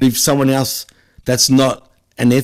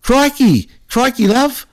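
much higher, hammed-up broad Aussie Crikey; mock Aussie accent; 9 semitones higher than usual. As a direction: send up a cartoon Aussie. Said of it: "She might as well just go".

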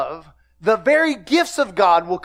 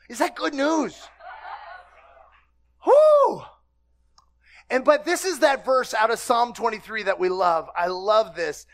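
normal talking voice

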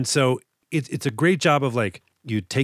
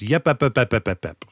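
mimicking Incredibles yep. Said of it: "he starts doing a little bit of this".

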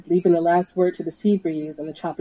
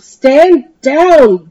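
higher, much louder bellow; 8 semitones higher, 14 dB louder than usual. Acted. Dee bellows at the helicopter, much louder. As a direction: roar the order out. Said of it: "Dee bellowed".

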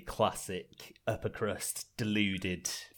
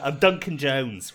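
loud, higher, slow announcement; mock-insistent self-introduction; imitated Duncan Jones; 6 semitones higher, 11 dB louder, slower than usual. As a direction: declare the name slowly and emphatically. Voicing the renamed son's protest.

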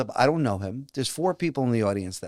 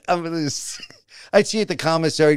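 ordinary talk

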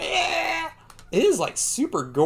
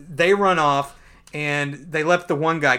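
ordinary talk

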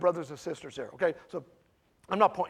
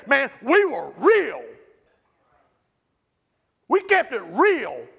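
loud, raised-pitch pulpit delivery; forceful preaching; 5 semitones higher, 9 dB louder than usual. Real, not acted.

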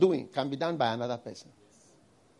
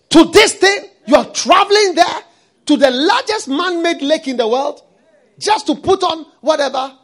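full-force preaching; 9 semitones higher, 12 dB louder than usual. Acted. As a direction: hammer each phrase at the congregation.

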